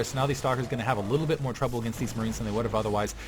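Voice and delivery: ordinary talk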